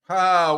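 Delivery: loud, high scornful ha; one loud, derisive burst of fake laughter; mocking "ha". Reading own reply to a critic, loud mocking ha.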